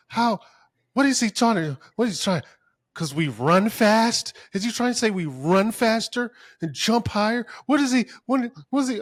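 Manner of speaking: high, loud offended questioning; hammed-up incredulous outrage; mock indignation. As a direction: act out someone loudly taking offense. Voices offended people's indignant questions.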